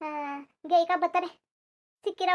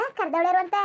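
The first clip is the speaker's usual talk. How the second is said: ordinary talk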